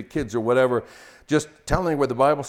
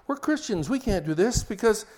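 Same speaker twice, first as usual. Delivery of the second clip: much higher, whiny pious husband; sanctimonious husband; 8 semitones higher, 3 dB louder than usual. Pretend. Husband reminding his wife they're Christians, high and pious. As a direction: pitch it up like a self-righteous husband.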